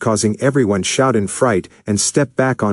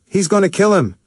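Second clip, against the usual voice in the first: very high, urgent alarm; frightened cry; 11 semitones higher than usual. A narrator's reading. Linda crying out in fear as Kabuto is beaten. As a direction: cry out in fear for his life. Linda panicking over the beating.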